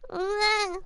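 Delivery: a high squalling mock baby wail; baby cry noise. Baby crying in the courtroom, a hammed-up wail. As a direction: imitate a crying infant, playfully. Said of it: "most accurate baby cry noise I've ever made".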